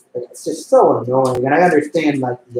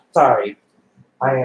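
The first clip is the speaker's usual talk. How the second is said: normal talking voice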